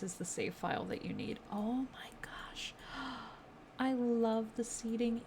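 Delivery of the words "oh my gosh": soft breathless whisper; awed whisper. Real, not acted. Gamer whispering as a new room appears. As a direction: whisper it, stunned by what you see. Seeing the club interior.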